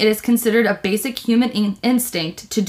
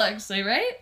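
ordinary talk

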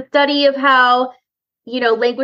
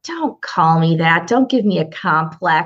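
low, slow mock protest; 7 semitones lower, slower than usual. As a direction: drag it out, lower. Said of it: "I'm like".

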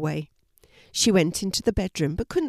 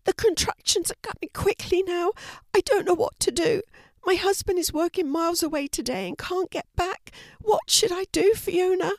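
high, strained voice of a panicking mother-to-be; Stacy in labour; 13 semitones higher than usual. A narrator's reading. Stacy in labour begs Fiona for help, high and strained. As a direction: plead for help through the pain.

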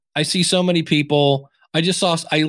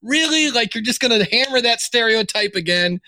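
exasperated disbelief; 8 semitones higher than usual. Retold or imitated. Exasperated reaction to cliché.

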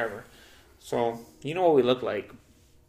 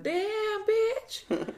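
much higher, squeaky mock "Damn, bitch"; mock squeal. Imitating a friend mocking the sale price, a high squeal. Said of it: "He's like".

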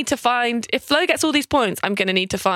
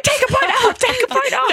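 mock bickering kids; 13 semitones higher than usual. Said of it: "pointing at each other like".

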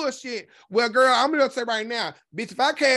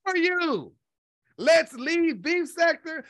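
incredulous retort; 7 semitones higher, slower than usual. Retold or imitated. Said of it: "I'm looking like".

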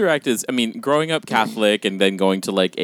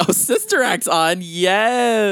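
excited; 11 semitones higher, much slower than usual. Retold or imitated. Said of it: "I was like".

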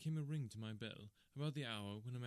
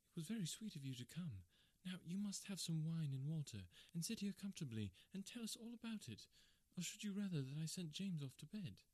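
higher woman's voice, soothing; comforting wife; 7 semitones higher than usual. Acted. Wife comforting distressed Kate, higher soothing voice. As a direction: play the wife calming her friend.

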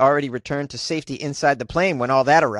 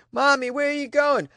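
put-on child voice; 11 semitones higher than usual.

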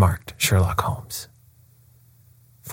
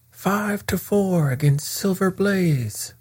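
high, drawn-out bookmaker's cry, held back in volume; bookmakers' roar; 11 semitones higher, 3 dB quieter, slower than usual. A narrator's reading. Betting ring calling odds over the racecourse, a high roaring cry. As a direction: call the odds high and slow, stretching the words. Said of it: "roared the ring".

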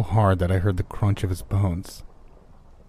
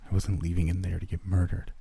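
usual speaking voice

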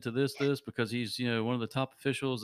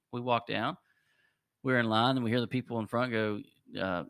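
usual speaking voice

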